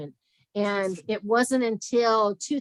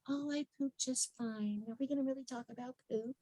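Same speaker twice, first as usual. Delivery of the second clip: a lightly voiced squeamish client, higher and much softer; embarrassed client; 4 semitones higher, 13 dB quieter than usual. Client squirming at a nutritionist's poop question, mock-hushed and higher. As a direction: hush it like a client caught off guard, a bit quick.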